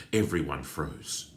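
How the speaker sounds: plain reading voice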